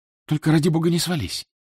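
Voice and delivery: light, careful warning; whispered warning. A narrator's reading. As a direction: lift the pitch for a hushed female character. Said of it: "прошептала она".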